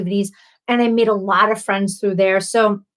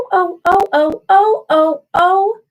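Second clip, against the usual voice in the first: squealing ohs; 8 semitones higher, 5 dB louder than usual. Real, not acted. Overwhelmed by something, squealing.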